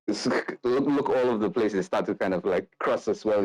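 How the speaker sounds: usual speaking voice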